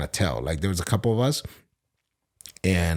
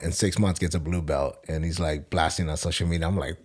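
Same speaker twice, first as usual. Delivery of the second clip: ordinary talk, nothing marked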